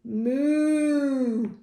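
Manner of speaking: drawn-out mooing cow. Acted. Cow's line in a farm story, mooing. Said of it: "And the cow said".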